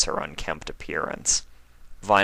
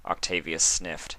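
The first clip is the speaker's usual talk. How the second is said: ordinary delivery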